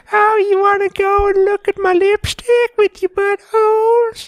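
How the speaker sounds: put-on high-pitched Deep South little-girl voice; Deep South kid. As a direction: imitate a squeaky child with a Deep South accent. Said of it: "sound like she was from the deep south".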